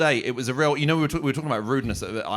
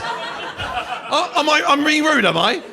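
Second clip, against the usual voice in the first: much higher, louder incredulous squeak; exasperated protest; 6 dB louder, much slower than usual. Retold or imitated. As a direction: squeal it in disbelief. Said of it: "And you go".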